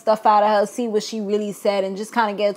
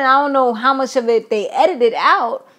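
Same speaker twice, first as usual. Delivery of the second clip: ordinary talk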